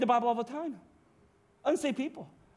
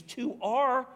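OUR lifted high in pitch; stress on OUR; 4 semitones higher than usual. Shown as a demonstration. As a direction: hit the word "our" with a high lift. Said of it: "listen to that".

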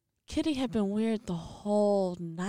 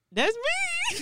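high, rising little admission; playful guilty owning up; sheepish that's me. Retold or imitated. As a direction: squeak it out sheepishly. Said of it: "And I was just like".

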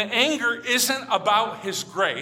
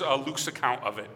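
ordinary delivery

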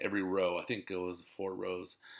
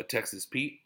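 ordinary talk

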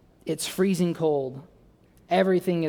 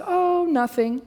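high, drawn-out mock-coy wife voice; lilting high impression of a wife; imitating wife; 12 semitones higher, slower than usual. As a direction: play the wife, high and stretched out. Voicing wife's coy answer in story.

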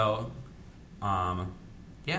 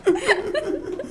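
laughing; 8 semitones higher, 7 dB louder than usual. Real, not acted. Laughing loudly.

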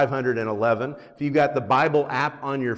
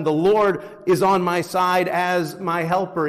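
raised, ringing verse reading; scripture reading; 6 semitones higher, 4 dB louder than usual. Acted. Pastor quoting verse seven to congregation, proclaiming. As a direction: read the verse out with force.

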